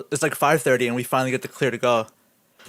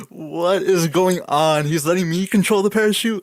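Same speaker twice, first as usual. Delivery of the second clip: retold disbelief; 4 semitones higher, 4 dB louder than usual. Novice skydiver handed the parachute controls, retold disbelief. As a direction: exclaim in hammed-up disbelief.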